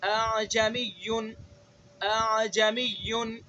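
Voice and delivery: اعجمیون drawn out slowly, second hamza eased between sounds; softened second hamza. Shown as a demonstration. Teacher repeating اعجمیون for students with the eased hamza. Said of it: "دوسرے حمزہ کے اندر تسہیل کرنی ہے".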